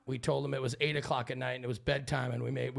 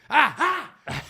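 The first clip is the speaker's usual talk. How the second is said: high, loud yelp back; mock startled yell; 11 semitones higher, 8 dB louder than usual. Wife jolted round the corner yells back, mock startled yell. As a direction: imitate a short yell back at someone. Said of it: "She just yells back".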